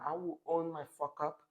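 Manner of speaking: usual speaking voice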